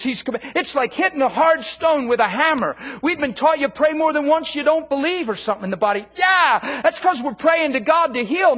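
loud, high, driving sermon delivery; fiery preaching. Acted. Preacher fires up congregation about commanding healing, loud and driving. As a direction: preach it hard to the crowd.

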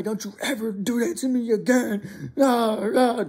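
high-pitched tearful scolding; hammed-up weeping tirade; mock hysterical crying. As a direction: imitate a mom yelling through sobs, trailing into wailing noises. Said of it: "like that, crying hysterically".

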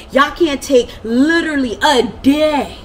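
slow, loud, high scolding; yelling. Retold or imitated. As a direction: hammer it slowly and loud. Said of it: "the whole neighborhood heard me".

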